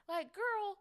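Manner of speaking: high, loud exasperated cry; exasperated girl. Acted. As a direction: throw up your hands in disbelief. Exasperated outburst to self.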